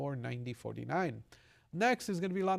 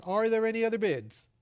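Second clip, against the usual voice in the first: raised call for last bids; 6 semitones higher, 5 dB louder than usual. Real, not acted. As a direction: call out to the room.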